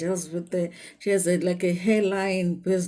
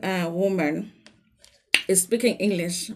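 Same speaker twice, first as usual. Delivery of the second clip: usual speaking voice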